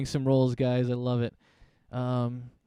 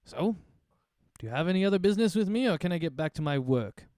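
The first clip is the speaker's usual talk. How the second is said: fast, higher, impatient merchant; brisk shopkeeper; 6 semitones higher, 3 dB louder than usual. Acted. Shopkeeper asks if there's other business, fast and impatient. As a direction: hurry the customer along. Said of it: "Leafrim looks at you and says".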